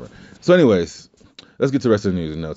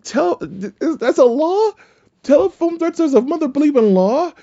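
much higher, louder falsetto disbelief; incredulous squeak; 3 dB louder than usual. Retold or imitated. Inmate retelling his disbelief at a jail law, squeaky high falsetto. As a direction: squeal it like you can't believe it's a law.

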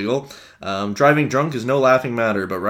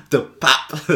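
put-on French accent; 4 semitones higher, 3 dB louder than usual. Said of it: "Your French accent".

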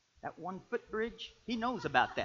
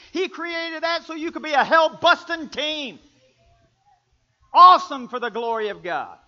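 loud, high, driving preacher's voice; preaching at full force; 10 semitones higher, 15 dB louder than usual. Acted. Pastor rousing the congregation about hell-busting couples, full-force preaching. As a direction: preach it out, fired up and booming.

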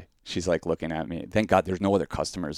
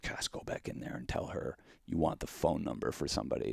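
hushed, breathy plotting; conspiratorial whisper in the car; whispered scheming; 3 semitones lower, 9 dB quieter than usual. Retold or imitated. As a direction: whisper the plan to your friend. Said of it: "and I was like".

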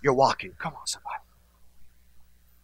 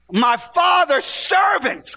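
high, slow shouted cry; preacher's shout; 13 semitones higher, 5 dB louder, much slower than usual. Acted. Prodigal son shouting about his father's servants. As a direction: cry it out to the congregation.

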